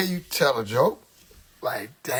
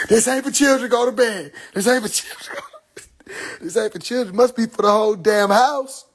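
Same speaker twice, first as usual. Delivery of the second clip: imitating mom; 6 semitones higher than usual. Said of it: "talking about some".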